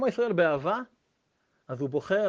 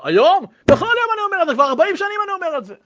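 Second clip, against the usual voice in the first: much higher, louder indignant outburst; indignant surprise; 14 semitones higher, 11 dB louder, faster than usual. Retold or imitated. Old man tells the rabbi he has said it daily for forty years, indignant and loud. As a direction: protest in outraged disbelief.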